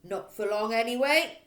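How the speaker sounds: loud gruff bark. Acted. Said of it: "Miss Trunchbull barked".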